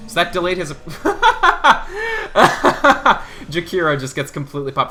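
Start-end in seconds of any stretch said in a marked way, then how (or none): 0.8-3.5 loud high-pitched burst of laughter; helpless high cackling laugh; real laughter. Real, not acted. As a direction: crack up mid-sentence, loud and high. Cracks up mid-commentary.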